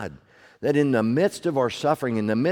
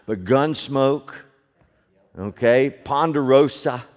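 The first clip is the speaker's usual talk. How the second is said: ordinary talk, nothing marked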